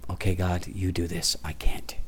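a whispered confession of helplessness to God; hushed prayer. Retold or imitated. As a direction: let go of it in a near whisper. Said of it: "It's like".

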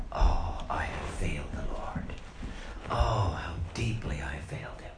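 whispered, despairing grief; broken whisper. Retold or imitated. Peter weeping after his denial, broken whisper. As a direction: barely breathe the words in shame.

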